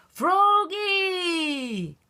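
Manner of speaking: high yelled name; yelling. Acted. Dad yelling to Froggy in the last minute, high yell. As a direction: call him out loud. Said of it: "yelled his dad".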